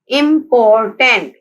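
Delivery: Important slowly split as im, por, tant; syllable by syllable. Shown as a demonstration. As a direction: separate each syllable of important. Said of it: "important के three syllables हैं".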